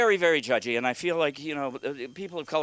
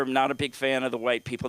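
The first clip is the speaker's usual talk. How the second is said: ordinary delivery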